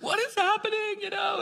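high astonished woman's voice; amazed woman. Retold or imitated. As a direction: squeal it out in disbelief like her. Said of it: "she's like".

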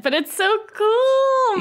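high, drawn-out gush; excited. Real, not acted. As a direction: rave about how cool it is. Raving about glitter scene.

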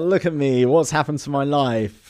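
hammed-up woe, dragging it out; mock self-pity. [name] laments his life after the summons, mock despair.

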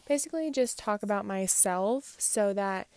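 ordinary talk

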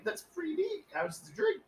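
high, sing-song fake approval; mock-polite. Retold fake-polite reply to drinker.

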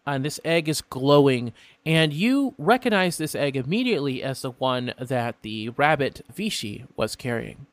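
ordinary talk

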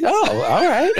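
hammed-up disappointed Eric Idle character; mock deflated villain. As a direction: squeak out a crestfallen surrender. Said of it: "He goes".

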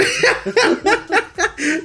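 high laughter that trails off; long high laugh. Real, not acted. Laughing after the joke.

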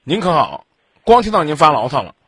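usual speaking voice

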